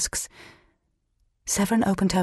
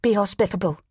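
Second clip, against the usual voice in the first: brief, tight-lipped instruction. A narrator's reading. Old woman reminds her apprentice of hospitality, tight-lipped. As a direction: press it out with restraint. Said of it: "tight-lipped".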